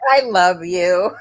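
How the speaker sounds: laughing. Real, not acted. Laughing at comment.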